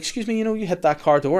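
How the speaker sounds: high, overly polite question; sweetly polite but pointed; mock polite. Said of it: "Catherine's like".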